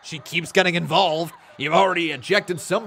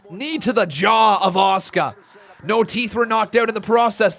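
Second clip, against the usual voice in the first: excited, louder, higher ringside calls; live play-by-play; 6 semitones higher, 8 dB louder, slower than usual. Acted. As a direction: call the match as it happens.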